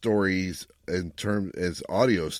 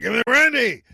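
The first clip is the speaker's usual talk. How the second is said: high, loud hammed-up cry for brandy; mock drunken demand; 9 dB louder than usual. Cohost jokes about too much brandy, hammed-up high loud demand. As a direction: bellow it like a tipsy sailor demanding more.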